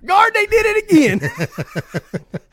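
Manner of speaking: high, slow, drawn-out shout; yelling across the room. Pretend. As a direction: call out high and long to the guard. Mocking inmate calling guard.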